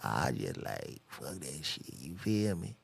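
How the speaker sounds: low, mumbled mock rapper talk; incoherent rapper impression. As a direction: slur through filler phrases like a rapper. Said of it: "they, they all the same shit".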